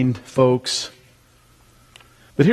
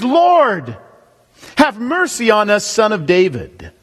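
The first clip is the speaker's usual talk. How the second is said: mock shriek; 8 semitones higher, slower than usual. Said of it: "Listen, they shrieked".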